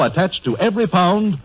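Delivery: ordinary talk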